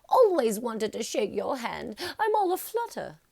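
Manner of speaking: high, loud, fluttery squeal; aflutter fan. A narrator's reading. Starstruck fan tells Harry she's all aflutter, high and loud.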